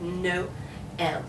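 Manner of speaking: "no ... L", paused between syllables; little break. Shown as a demonstration. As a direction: stop on NO, then start L. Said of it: "put a little break in there".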